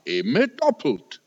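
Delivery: ordinary talk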